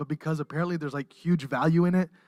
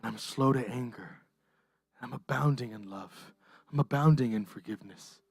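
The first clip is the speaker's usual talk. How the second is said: God's gentle answer; 8 semitones lower, 8 dB quieter than usual. Acted. God answering the wounded believer, soft and tender. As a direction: lower and much softer, tender and patient.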